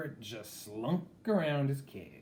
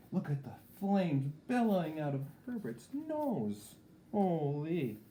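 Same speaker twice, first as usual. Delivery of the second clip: higher, awed reader aside; amazed aside; 6 semitones higher than usual. Acted. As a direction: marvel at the flames. Aside reacting to the picture.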